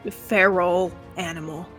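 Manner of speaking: ordinary talk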